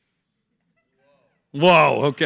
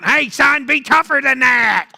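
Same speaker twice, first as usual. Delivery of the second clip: mock mom; 7 semitones higher, 4 dB louder than usual. Voicing mom nagging.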